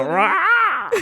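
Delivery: high mock yell "Rah!" with a laugh; the villain's roar, giggling; loud, high, laughing roar. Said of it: "You just gotta go".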